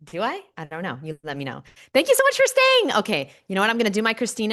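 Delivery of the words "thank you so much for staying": very high, loud, thrilled thanks; squealed thanks. Real, not acted. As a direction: squeal it out to the viewers. Thanking viewers who stayed.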